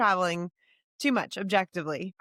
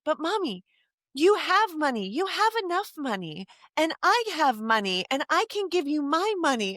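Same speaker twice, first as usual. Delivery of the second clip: put-on high, earnest little-boy voice; imitating her son offering his money; young son; 8 semitones higher than usual. Mom voicing her young son.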